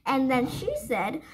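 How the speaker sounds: ordinary delivery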